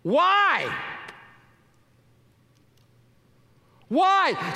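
high, loud indignant cry; indignant why. Retold or imitated. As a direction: demand it in outrage. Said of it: "said to themselves indignantly".

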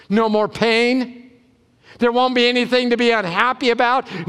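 ordinary talk, nothing marked

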